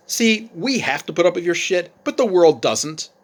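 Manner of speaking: father scolding his daughter about the real world, loud and heated; angry father lecture. Retold or imitated. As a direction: lay into her like a fed-up dad. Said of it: "he adds".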